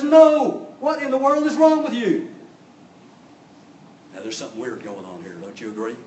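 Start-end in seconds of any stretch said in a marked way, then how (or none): 0.0-2.3 loud, high, exasperated Balaam; indignant outburst. Acted. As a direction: act out an angry master scolding his donkey. Balaam rages at his donkey.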